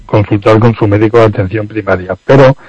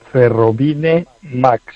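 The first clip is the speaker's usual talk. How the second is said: slow dictation; 2 semitones higher, much slower than usual. Shown as a demonstration. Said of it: "termina en".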